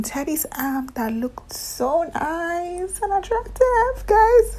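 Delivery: high, slow, swooning over his arm; a gushing fangirl squeal rising to a loud peak. Real, not acted. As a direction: melt over how attractive he is.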